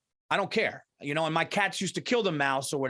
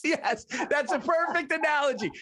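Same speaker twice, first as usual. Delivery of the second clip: ordinary talk, nothing marked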